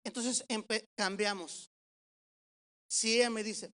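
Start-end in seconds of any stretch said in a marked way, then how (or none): none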